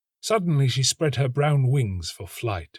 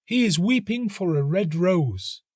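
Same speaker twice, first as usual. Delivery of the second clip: higher, knowing and gentle; understanding nightingale; 9 semitones higher than usual. A narrator's reading. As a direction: explain it tenderly. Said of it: "said the nightingale".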